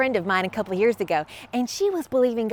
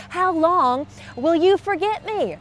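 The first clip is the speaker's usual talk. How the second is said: slow, higher pleading; crying out to God; 6 semitones higher, slower than usual. Acted. David asking God in the psalm, slow raised plea. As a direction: stretch it into an acted lament. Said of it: "he's crying out to God".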